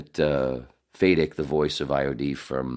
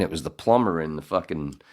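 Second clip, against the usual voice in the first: usual speaking voice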